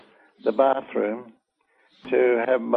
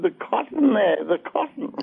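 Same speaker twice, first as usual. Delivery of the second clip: raised pitch, contained volume, easing off at the end; retold protest; 9 semitones higher than usual. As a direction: re-enact an urgent, pained plea. Re-enacting her pained protest to technician.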